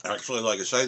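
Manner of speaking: ordinary talk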